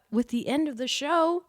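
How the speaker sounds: ordinary talk, nothing marked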